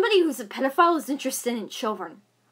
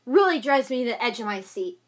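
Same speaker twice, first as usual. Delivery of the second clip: ordinary talk, nothing marked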